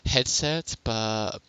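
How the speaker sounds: normal talking voice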